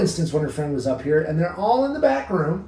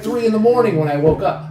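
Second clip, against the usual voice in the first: ordinary talk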